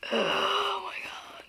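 high, slow whispered cry; drawn-out breathless squeal; overwhelmed, near tears. Real, not acted. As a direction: whimper it with overjoyed excitement. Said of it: "this is where I start crying".

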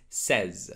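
SAYS with a short E, like "sez"; correct says. Shown as a demonstration. Teacher modelling says for learners, short E. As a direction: say "says" to rhyme with "fez". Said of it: "It is not say".

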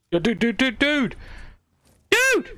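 rising, repeated high cries; frantic calling. Real, not acted. Calling out urgently in the firefight, frantic. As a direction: build from urgent to a high shriek.

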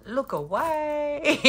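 slow, laughing look away; drawn-out look away through a laugh; laughing warning. Real, not acted. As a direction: warn them off while giggling.

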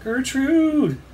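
drawn-out high cry for a friend; boy's cry. A narrator's reading. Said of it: "cried the boy".

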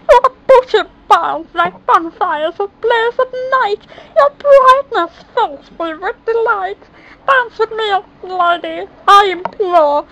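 high, loud courtly prince; charming prince. A narrator's reading. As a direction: woo her like a smitten prince. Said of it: "his Charming said".

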